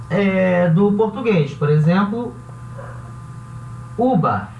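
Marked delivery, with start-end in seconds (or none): 4.0-4.5 uba dita com B, não V. Shown as a demonstration. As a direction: pronuncie uba com os lábios fechados no B. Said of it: "eu falei essa palavra com som de B". (uva falada como uba)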